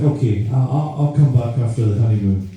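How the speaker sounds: ordinary talk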